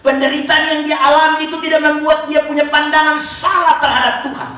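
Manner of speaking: high, forceful preacher's shout; full-force preaching. Real, not acted. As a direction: drive it home to the congregation.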